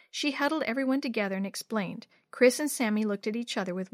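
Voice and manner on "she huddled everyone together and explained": usual speaking voice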